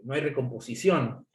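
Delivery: normal talking voice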